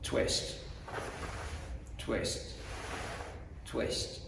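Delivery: whispered twist, twist, twist; soft unvoiced demo of each twist; quiet counting. Shown as a demonstration. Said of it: "So from here".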